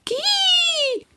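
very high, startled owl shriek; a sudden shocked bird call; surprised owl cry. A narrator's reading. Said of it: "rief der Steinkauz überrascht".

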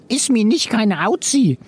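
high little creature voice; all-clear chameleon voice. A narrator's reading. Rosso after his fall, high creature all-clear. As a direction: reassure them in a high chameleon voice. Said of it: "gab er Entwarnung".

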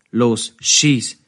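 forceful push to fire; urgent command. A narrator's reading. Herr Spieß presses the frozen operator to shoot, urgent command. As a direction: urge them on with force.

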